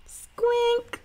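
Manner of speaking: high, pleased squeal of a word; happy boy. A narrator's reading. Little brother pleased with himself, high. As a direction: squeak it out proudly. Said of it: "said Stink".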